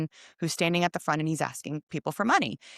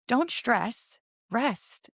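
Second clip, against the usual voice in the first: higher, tender reassurance from the stranger; lightly voiced kind stranger saying don't stress; stranger's comfort; 5 semitones higher, much slower than usual. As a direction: gently comfort her like a kind old friend. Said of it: "and he goes".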